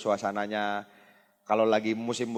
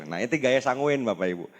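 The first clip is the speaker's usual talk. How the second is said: ordinary talk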